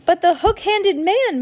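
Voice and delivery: high, loud protest; shouted. A narrator's reading. Violet protesting to Mister Poe, high shout. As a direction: shout it high in urgent objection. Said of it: "Violet shouted".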